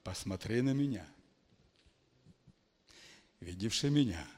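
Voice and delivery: ordinary delivery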